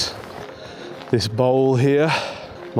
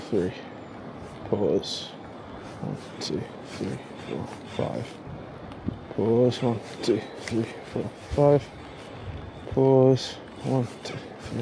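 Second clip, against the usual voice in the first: quiet counting; 9 dB quieter than usual. Real, not acted. Counting cast rhythm under breath.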